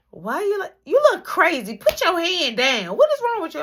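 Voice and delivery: high, loud scolding mother; scolding mom. Retold or imitated. Mom telling her son to put his hand down, scolding. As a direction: snap at your kid to put his hand down.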